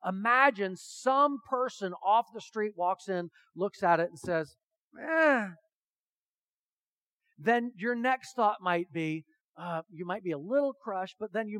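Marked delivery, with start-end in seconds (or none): 5.0-5.5 a high, loud, dismissive eh; unimpressed eh. Retold or imitated. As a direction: brush the painting off with one grunt. Stranger shrugs off the painting.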